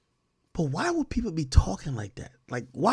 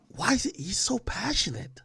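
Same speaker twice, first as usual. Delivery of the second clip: whispered; 6 semitones higher, 8 dB quieter than usual. Real, not acted. Baffled aside after the rant clip.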